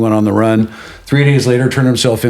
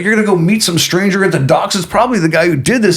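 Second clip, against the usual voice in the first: lightly voiced worried mother, higher; alarmed mom; 6 semitones higher than usual. Mom warning her child off a stranger, mildly alarmed. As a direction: warn like an exasperated mother, pitched up. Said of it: "She's like".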